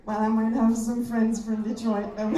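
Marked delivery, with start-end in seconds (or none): none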